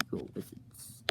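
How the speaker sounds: a soft awed whisper from the wizard. Acted. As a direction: breathe the words in awe. Epicard reacts to the wizards.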